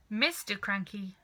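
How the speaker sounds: plain reading voice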